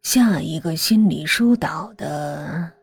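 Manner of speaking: slow, soft sinister voice; quiet ominous master. A narrator's reading. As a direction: plan the next step calmly, menacingly.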